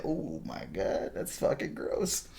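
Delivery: slow, soft near-whisper of disgust; hushed disgust. Retold or imitated. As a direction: breathe out your revulsion quietly. His own disgusted reaction retold.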